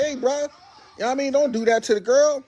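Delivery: calming the fight. Retold or imitated. Man breaking up a fight, high placating plea. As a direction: talk him down, high and easy. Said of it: "I'm trying to break it up like".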